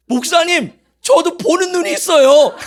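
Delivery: high, loud mock protest; indignant retort. Imitating girls answering their pastor back, indignant. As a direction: protest like an offended girl.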